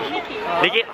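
normal talking voice

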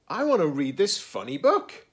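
high, loud, eager storybook voice. A narrator's reading. Gerald spots a funny book to read, eagerly.

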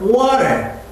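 American water with a flapped T, "wa-rer"; water in an American accent, flapped T. Shown as a demonstration. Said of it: "미국에서는".

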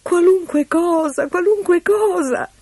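high, drawn-out pleading; supplica disperata. Acted. Eloisa disperata accetta tutto. (supplica disperata, voce acutissima)

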